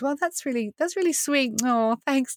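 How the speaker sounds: high, sweetly polite brush-off; playfully patronising thanks before declining; mock politeness. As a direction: pitch it up, humouring a kind offer. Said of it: "I just said to God".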